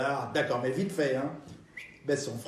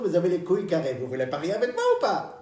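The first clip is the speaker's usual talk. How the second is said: ordinary talk, nothing marked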